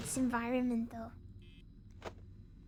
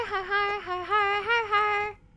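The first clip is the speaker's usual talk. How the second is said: "har, har" pitched higher and louder, hammed up; loud mock laugh; 7 semitones higher, 7 dB louder than usual. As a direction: crank the fake laugh up high and loud. Fake laugh variations.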